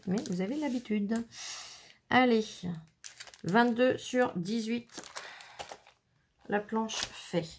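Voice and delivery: ordinary delivery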